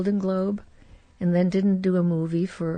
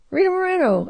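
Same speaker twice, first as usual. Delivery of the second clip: much higher, bright mock greeting; producer greeting; 9 semitones higher, faster than usual. Norman Lear greeting her at the audition, high and bright. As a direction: call her name out, delighted. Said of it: "and he says".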